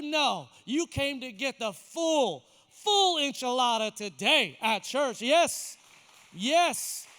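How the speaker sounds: loud, insistent pulpit exhortation; fired-up sermon delivery; forceful preaching. Real, not acted. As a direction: press each point on the congregation.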